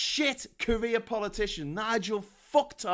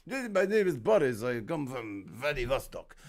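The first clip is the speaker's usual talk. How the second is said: slow, drawn-out fake Russian villain; hammed-up Russian accent, slow and menacing; cartoon Russian villain accent; 7 semitones lower, slower than usual. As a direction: do a bad Russian baddie, veering into Transylvania. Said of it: "a cartoon Russian's name".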